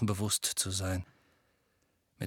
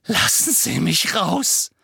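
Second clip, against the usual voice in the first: demanding release; 5 semitones higher, 6 dB louder than usual. Acted. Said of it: "sagte er".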